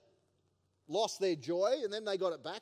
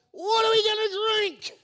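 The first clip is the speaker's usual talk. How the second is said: high, loud whining cry of the grumbling crowd; whiny complaint; 13 semitones higher, 9 dB louder than usual. Acted. Preacher voicing grumbling Israelites, high loud whine. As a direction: wail it like a complaining mob.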